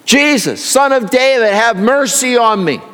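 a blind beggar yelling to be heard. Retold or imitated. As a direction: call out over the crowd, begging. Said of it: "this guy just starts yelling".

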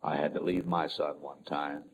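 soft, low and reassuring; gentle divine voice. Retold or imitated. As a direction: say it like a comforting father. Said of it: "the Heavenly Father said".

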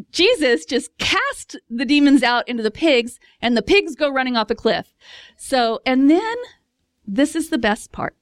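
normal talking voice

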